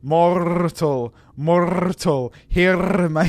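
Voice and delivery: "Mortal, mortal, hear my" with long trilled R's; rolled R's. Shown as a demonstration. Streamer tests rolling the R's in "Mortal, mortal, hear my". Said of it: "just roll the R's".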